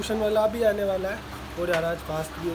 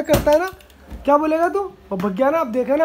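louder, exasperated scolding; telling off; 5 semitones higher, 7 dB louder than usual. Real, not acted. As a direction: snap at the interrupter. Telling off the interrupting one. (झुंझलाकर ऊंची आवाज़ में डांटना)

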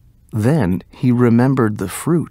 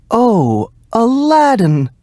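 high excited cry of surprise; mother crying out; 11 semitones higher, 4 dB louder, slower than usual. Acted. Mother excitedly greeting the supposed uncle. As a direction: gasp out his name in glad surprise. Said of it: "cried his mother".

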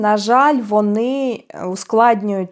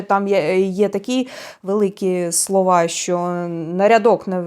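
normal talking voice

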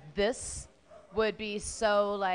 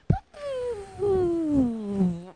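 a burst of high laughter; 9 semitones higher, 6 dB louder than usual. Real, not acted. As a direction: laugh it off, embarrassed. Embarrassed laugh at own story.

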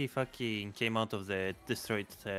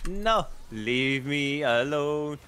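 drawn-out plea; 4 semitones higher, 7 dB louder, much slower than usual. Real, not acted. Being chased in game.

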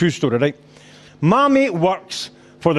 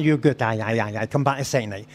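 low, soft run of ayes; offhand agreement; 8 semitones lower, 6 dB quieter than usual. Acted. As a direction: mutter a casual yes, low and quiet. Acted casual agreement to return.

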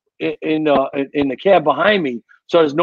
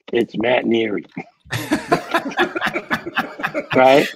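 ordinary talk

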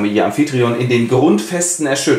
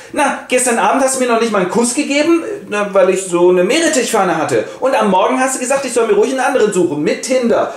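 higher, quick, aggrieved wife's voice; Charis voice; 9 semitones higher than usual. Acted. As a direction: play the offended wife, voice up high. Charis complains to her husband. (hohe, beleidigte Ehefrauenstimme)

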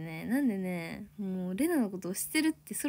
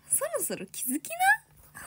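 much higher, slow sing-song tease; teasing nudge; slower than usual. Real, not acted. Streamer teasing viewers to notice her, sing-song. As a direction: coax them like a big sister. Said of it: "teasing viewers".

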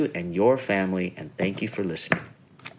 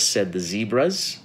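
usual speaking voice

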